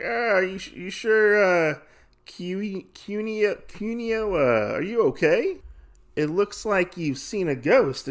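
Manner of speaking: high, hesitant questioning; acted concern. Drinkers check on the sick woman, concerned.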